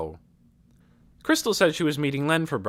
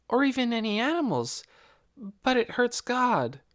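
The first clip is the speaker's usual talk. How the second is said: put-on high, light girl's voice; a curious young girl asking; young girl Nikki; 12 semitones higher than usual. A narrator's reading. Nikki's lines in the story.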